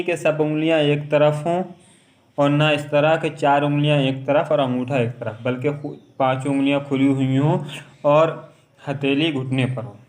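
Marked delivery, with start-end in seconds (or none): none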